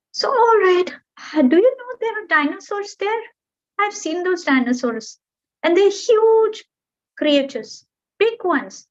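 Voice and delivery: high, wide-eyed storytelling bird; eager little bird. Acted. Bird amazing the mountain with tales of dinosaurs, high and eager. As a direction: marvel at the huge creatures.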